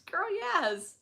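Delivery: high-pitched mock cheer; hyped agreement. Fan answering the singer's shoe vow, hyped agreement.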